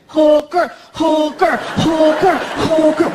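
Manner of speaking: put-on loud high chanting kid; kid tantrum. Son throwing a fit in the kitchen, loud high chant.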